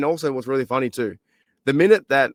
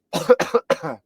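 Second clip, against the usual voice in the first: coughing; 5 semitones higher than usual. Real, not acted. Speaker with lost voice coughs.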